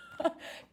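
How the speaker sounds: sudden high giggle; burst of laughter. Real, not acted. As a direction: break up laughing mid-name. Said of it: "hard for me to say that with a straight face".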